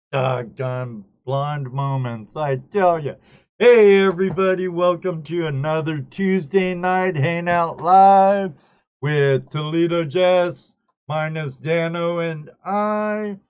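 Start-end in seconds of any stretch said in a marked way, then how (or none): 3.6-13.4 loud, higher-pitched host welcome; big, loud hello to the audience; show-opening announcement. Real, not acted. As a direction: kick off the live show at full volume. Opens the live show.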